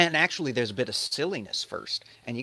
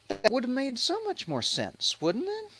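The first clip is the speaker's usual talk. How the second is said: sarcastic high aside; 13 semitones higher, 4 dB quieter than usual. Acted. Sarcastic aside on steel ark.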